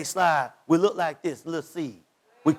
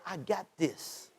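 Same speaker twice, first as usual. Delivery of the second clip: soft, slow hush; God reassuring; 3 semitones lower, 13 dB quieter, slower than usual. Acted. Preacher voicing God's calm promise, slow and soft. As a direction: say it low and quiet, unhurried. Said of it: "But God said".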